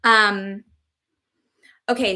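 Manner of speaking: ordinary delivery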